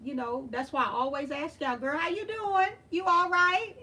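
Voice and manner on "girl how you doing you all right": high, loud and friendly greeting; upbeat check-in. Retold or imitated. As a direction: call out a lively check on a girlfriend. Acting out her usual check-in greeting.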